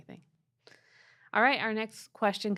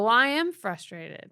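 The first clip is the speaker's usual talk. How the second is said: louder, higher acted exasperation; frustrated outburst; 4 semitones higher, 8 dB louder than usual. Sarcastic relative finally admitting feelings to the listener, frustrated. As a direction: blurt out the admission with force.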